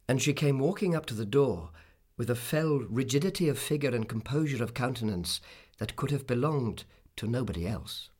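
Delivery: ordinary delivery, nothing marked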